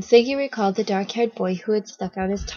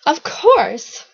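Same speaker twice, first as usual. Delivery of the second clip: loud, higher, cocky exclamation; cocky answer; 7 semitones higher, 6 dB louder than usual. A narrator's reading. Strange boy answering Siggy's question, cocky exclamation. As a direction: crow it as if it's obvious.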